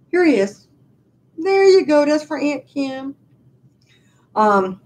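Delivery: usual speaking voice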